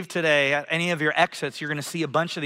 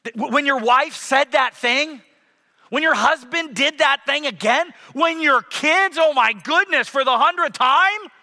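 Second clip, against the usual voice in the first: higher and louder, mock exasperation; exasperated buildup; 7 semitones higher, 5 dB louder than usual. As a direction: pile it on in mock frustration. Exasperated list of home annoyances.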